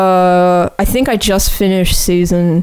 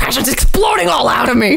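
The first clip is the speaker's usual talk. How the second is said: mock shout; 4 semitones higher than usual. Mocking friend's enthusiasm.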